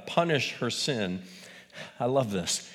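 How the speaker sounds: ordinary delivery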